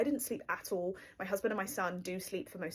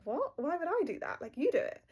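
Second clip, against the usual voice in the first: mock disbelief; 8 semitones higher than usual. Answering husband who wants her to lease the car, incredulous. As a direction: pitch jumps up on What and Why. Retelling her shocked reply.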